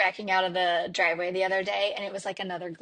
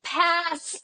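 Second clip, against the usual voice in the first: high, imitated child saying it from behind; little girl's voice; 7 semitones higher than usual. Daughter in the back seat rejecting a song, little voice. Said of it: "I hear her little voice from the back".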